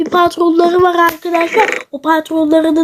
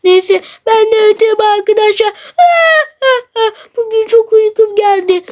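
ordinary talk, nothing marked

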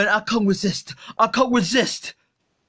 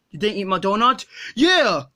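usual speaking voice

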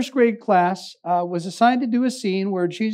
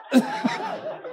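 laugh; 8 dB quieter than usual. Real, not acted. Laughs at own punchline.

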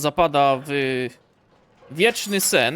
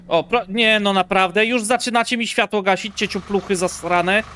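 loud, raised-pitch griping; exasperated scolding; 3 semitones higher, 5 dB louder than usual. Pretend. Player cursing those switching lights off, exasperated scolding. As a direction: protest loudly at the pranksters.

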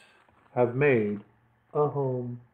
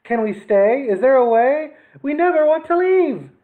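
high, loud begging kitten voices; pleading kittens; 10 semitones higher, 6 dB louder than usual. A narrator's reading. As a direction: plead to stay, rising higher. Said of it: "added pleas".

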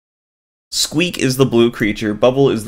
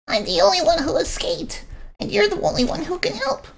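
very high, soft tiny voice; pleading squeaky creature; 5 dB quieter than usual. Acted. Squeak begs Bubble for help, tiny and pleading.

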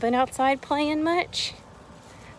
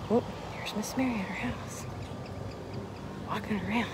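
hushed whisper, much softer; 12 dB quieter than usual. Real, not acted. Teacher on camera whispering to her pupils. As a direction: whisper it like spotting someone.

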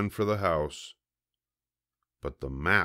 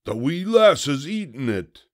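loud, high cry of dismay; pirate yell; 8 semitones higher, 7 dB louder than usual. A narrator's reading. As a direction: yell it as a horrified captain. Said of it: "yelled Braidbeard".

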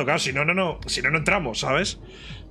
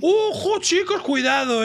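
high, drawn-out warning call; slow, high-pitched excited alert; excited heads-up; 11 semitones higher, slower than usual. Real, not acted. Playful warning to friends.

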